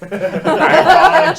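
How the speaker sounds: high loud laughing apology. Pretend.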